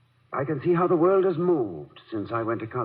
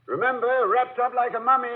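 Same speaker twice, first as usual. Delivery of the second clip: teasing echo; 13 semitones higher than usual. Acted. Husband teasingly repeats wife's advice.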